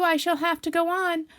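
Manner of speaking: high, loud effortful lament; loud, high worried cry; straining distress. Acted. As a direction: push the words out while wedged. Pooh stuck in the hole.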